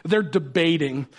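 normal talking voice

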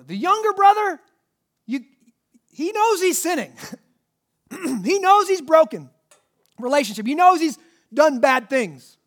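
high, loud preaching push; fiery preaching. Acted. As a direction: drive each "he knows" home from the pulpit. Preaching at full force.

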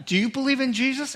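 high, fast incredulous question; pointed challenge. Retold or imitated. Pastor challenging the chaplain, high and fast. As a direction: fire the question at him in disbelief.

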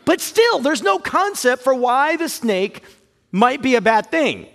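ordinary talk, nothing marked

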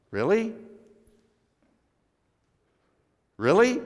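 high rising "Really?" in mock disbelief; can't believe what you just heard; incredulous. Real, not acted. Pastor challenges the congregation's us-versus-them talk, incredulous.

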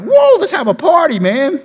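high excited whoop; preacher picturing himself celebrating; party excitement. Retold or imitated. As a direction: call out for a party. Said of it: "I would be like".

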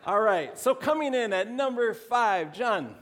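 high, loud countdown announcement; game-show host. Pretend. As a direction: call it out like a quiz host. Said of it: "kind of Family Feud style".

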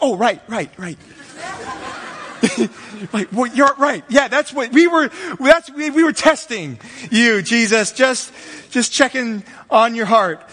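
a hint of awkward, laughing excuse-making; sheepish crowd backpedaling. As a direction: cover yourselves with a lame excuse.

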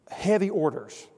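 normal talking voice

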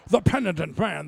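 lightly mock Connery in the pulpit; Sean Connery voice. Preachers imitating Sean Connery, a light Scottish Connery voice. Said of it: "Everybody turned into Sean Connery".

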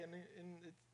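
ordinary talk, nothing marked

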